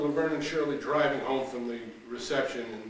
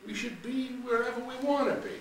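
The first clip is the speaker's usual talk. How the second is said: high, sing-song parroting of her friend; mocking echo; 12 semitones higher than usual. Laverne parroting Shirley's line back in a high mocking voice. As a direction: throw her words back at her. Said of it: "Like you said".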